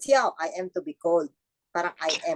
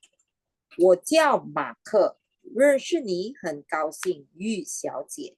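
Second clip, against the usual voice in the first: slow, careful Mandarin greeting; slow model line; slower than usual. Shown as a demonstration. Teacher models a slow Mandarin greeting. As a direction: spell it out slowly for the class.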